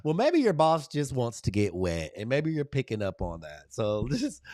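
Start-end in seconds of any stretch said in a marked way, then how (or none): none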